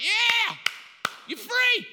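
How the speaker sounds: sudden high triumphant shout; a hammed-up celebration yell; mock cheer. Preacher mocks an easy conversion with a triumphant shout.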